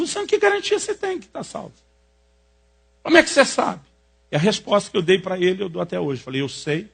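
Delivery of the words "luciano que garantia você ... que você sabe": high, pointed dramatic question; dramatic challenge. Retold or imitated. Pastor challenges Luciano on his salvation, high dramatic prodding. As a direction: put him on the spot, theatrically. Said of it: "Ele foi bem dramático".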